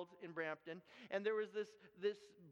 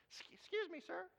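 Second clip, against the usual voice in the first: put-on higher, timid little boy; small child voice; 8 semitones higher than usual. A five-year-old boy timidly addressing the golf worker, higher. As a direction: ask politely like a shy five-year-old. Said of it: "I'm five years old".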